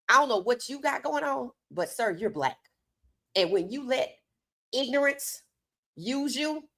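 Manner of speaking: ordinary talk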